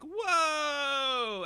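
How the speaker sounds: high, soft surprised "Whoa"; the player's character stepping back from the bear, startled. Acted. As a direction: gasp it out as you dodge. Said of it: "kinda go like".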